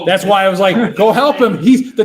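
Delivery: high, loud, laughing through words. Real, not acted. Laughing while telling.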